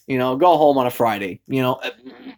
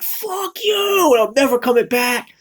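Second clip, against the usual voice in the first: much higher, defiant parting shout; high-pitched kiss-off out the window; 10 semitones higher than usual. Retold or imitated. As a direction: yell it out the car window. Retold defiant parting yell.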